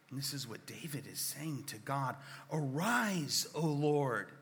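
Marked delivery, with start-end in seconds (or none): 2.5-4.3 urgent plea. Acted. Preacher voicing urgent psalm.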